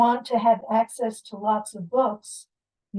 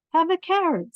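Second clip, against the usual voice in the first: higher, brighter offer of a carrot; mother bunny offering; 8 semitones higher, 4 dB louder than usual. Acted. Mother bunny offers her son a carrot, higher voice. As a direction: hand him the carrot kindly. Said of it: "said the mother bunny".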